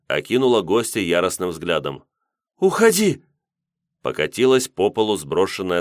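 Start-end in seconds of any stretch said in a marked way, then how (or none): 2.6-3.2 high, loud angry cry; furious order. Acted. Yulka orders her guest out, furious. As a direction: throw him out at the top of your voice.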